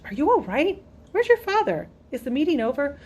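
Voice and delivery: a mother pressing her son with quick questions; worried mother. A narrator's reading.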